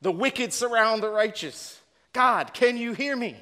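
high, loud desperate plea to God; anguished prophet. Retold or imitated. Preacher voicing Habakkuk crying to the Lord, high and pleading. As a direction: cry out like a prophet at the end of his rope. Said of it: "He says".

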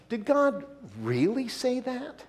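slow, high sly question stressed on God; sly Satan. Acted. Satan teasing Eve into doubt with a sly question. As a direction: sow doubt slowly and slyly. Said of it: "Satan saying to Eve".

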